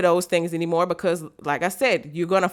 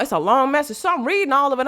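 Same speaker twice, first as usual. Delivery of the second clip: normal talking voice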